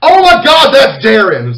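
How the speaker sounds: high, loud outburst of discovery; shocked realization. Retold or imitated. Dahlia suddenly realizes who this is, shocked. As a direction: exclaim it as it suddenly clicks.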